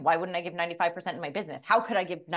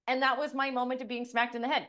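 usual speaking voice

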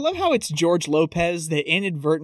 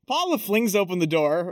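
normal talking voice

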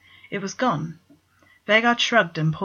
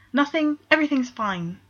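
high, flustered denial; nervous, flustered; 8 semitones higher than usual. A narrator's reading. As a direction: blurt it out, caught out. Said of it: "Vegard stuttered".

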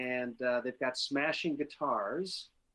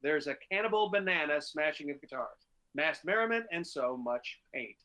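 upbeat promo read, pitched up; 3 semitones higher than usual. Acted. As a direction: read it like a radio band blurb. Reads band bio aloud.

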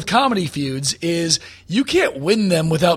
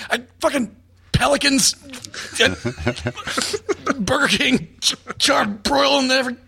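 higher-pitched, halting mock outrage; mock angry rant; 6 semitones higher than usual. Comic imagining himself out of things to hate, sputtering mock rage. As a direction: sputter as if grasping for targets.

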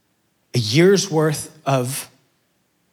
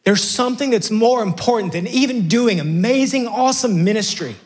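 Jesus pleading, building; 8 semitones higher than usual. Retold or imitated. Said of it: "You gotta hear this".

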